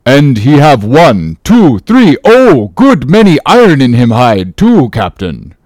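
halting, emphatic outburst; disjointed cry. Acted. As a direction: cry it out in broken pieces. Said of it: "cried Queequeg disjointedly".

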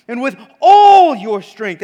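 loud, ringing declamation; proclaimed. Acted. Preacher reciting Jesus' command, booming it out. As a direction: call it out with full force.

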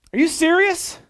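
loud, high incredulous question; high-pitched, forceful disbelief; acted disbelief. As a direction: imitate a woman who can't believe it. Woman's disbelieving reply, retold.

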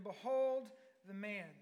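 loud, drawn-out announcement; loud proclamation. Acted. Pilate presenting Jesus to the crowd, loud slow proclamation. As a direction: stretch it out slowly and proclaim it.

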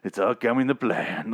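a hint of a scheming villain savoring his win; evil Negan. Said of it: "My evil Negan voice".

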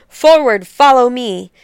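rallying order. Acted. Queen on horseback rallying armed citizens with a loud command. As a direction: call them to charge. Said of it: "mounted her fiery steed and ordered".

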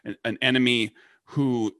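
ordinary delivery

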